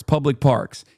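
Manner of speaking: usual speaking voice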